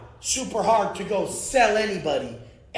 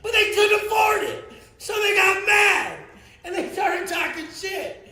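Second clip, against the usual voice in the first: angry rant; 9 semitones higher than usual. Real, not acted. Ranting about critics.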